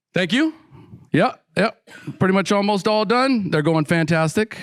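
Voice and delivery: breezy self-satisfied. Retold or imitated. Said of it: "his own cocky reply to sponsor".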